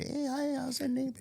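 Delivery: high, soft neighborly greeting; friendly neighbor. Acted. Neighbor greeting the partier next day, high and friendly. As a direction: pitch it way up, politely sing-song.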